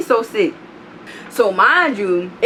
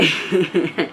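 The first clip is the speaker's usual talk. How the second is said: short laugh; 3 semitones lower than usual. Real, not acted. Laughs at own story.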